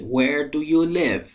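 live with a short i, like "liv" in "give"; pronunciation of live. Shown as a demonstration. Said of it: "به تلفظ کلمهٔ live دقت کنید".

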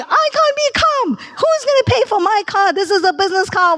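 imitating the brother's high panicked yell down the phone; mock screaming. Retold brother screaming at her on the phone, high mock yell. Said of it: "He starts screaming at me".

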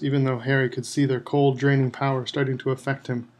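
plain reading voice

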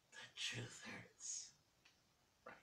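slow hushed whisper; teasing whisper. Acted. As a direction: whisper it as a smug secret. Teasing closing remark.

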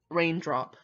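ordinary delivery

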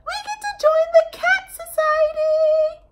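squeaky, very high excited squeal; excited mice. Acted. Mice cheering at the invitation, squeaky and high. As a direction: squeal it like thrilled little mice. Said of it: "The mice were very excited".

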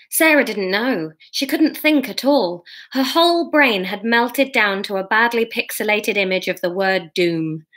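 ordinary delivery

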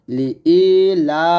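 "লি ই লা" with a stretched ই; drawn-out vowel. Shown as a demonstration. Teacher showing students the one-alif stretch, drawn-out ই. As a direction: hold the ই in "লি ই" for one alif's length. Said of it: "ইতে আমরা এক লিপ টান দিব".

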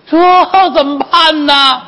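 high, slow theatrical wail; long, high cry of helplessness; drawn-out fretting. Acted. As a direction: stretch it out at a high pitch, at a loss. Madam fretting, at a loss.

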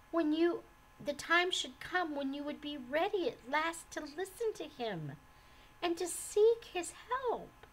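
high, urgent persuasion; heartfelt, insistent reassurance; earnest. A narrator's reading. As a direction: plead sincerely, with emphatic swoops of pitch. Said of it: "cried Mercy earnestly".

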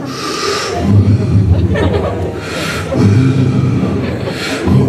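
long, noisy blown breath; slow-motion sound effect. Retold or imitated. Acting out a girl running in slow motion, long breathy whoosh. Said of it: "running in slow motion, like".

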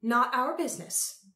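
high, loud imperious Empress; announcing Empress. A narrator's reading. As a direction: dismiss them like an empress. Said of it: "announced the Empress".

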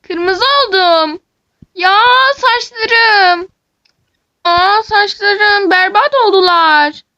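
high, loud, slow lament; distraught wail. Acted. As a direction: wail over your ruined hair, dragging it out. Wailing over red hair. (ağlamaklı sızlanma)

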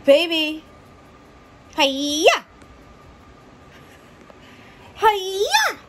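high, loud cooing to a pet. Real, not acted. Greeting pet baby.

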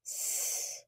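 hissed S sound, voiceless; isolated S sound. Shown as a demonstration. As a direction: say S as a long hiss. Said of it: "the letter S makes the sound".

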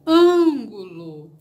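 ÂNGULO with a closed, nasal first A, loud and drawn out; som fechado. Shown as a demonstration. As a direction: stretch the closed Â of Ângulo, hit it hard. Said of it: "Percebam que o som é fechado". ("Ângulo" devagar, com o fechado e forte)

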